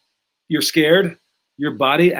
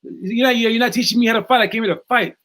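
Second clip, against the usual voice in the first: put-on higher, insistent protest from the kid; frustrated student; 6 semitones higher than usual. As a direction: push back, wanting to fight. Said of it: "He goes".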